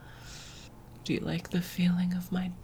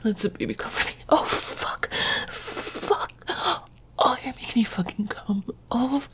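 strained, breaking cries jumping much higher; climax moans; 6 semitones higher than usual. Acted. Lover climaxing with his partner, gasping moans. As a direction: let it spill out in gasping, cracked bursts. Speaker climaxing during the scene.

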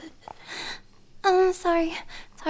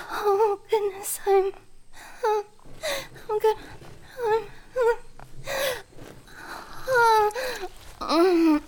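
climaxing moans; 5 semitones higher, slower than usual. Acted. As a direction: build up and lose control. Climax building, high moans.

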